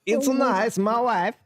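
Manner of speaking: put-on high-pitched comic Kazakh accent; Borat. Host carrying on the film impression, high comic Kazakh accent. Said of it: "Get to Borat in Kazakhstan now".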